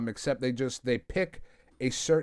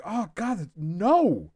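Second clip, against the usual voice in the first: dismayed cry; 5 semitones higher, much slower than usual. Retold or imitated. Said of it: "I was like".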